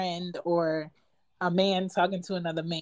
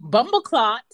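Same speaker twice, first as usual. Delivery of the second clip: put-on Jamaican accent; 5 semitones higher, 6 dB louder than usual. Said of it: "Jamaicans say bumbleclot".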